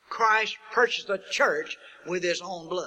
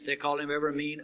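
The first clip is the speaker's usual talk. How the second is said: usual speaking voice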